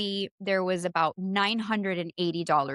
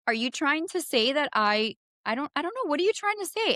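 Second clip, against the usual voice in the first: indignant; 7 semitones higher than usual. Retold or imitated. Agent implies she is guilty.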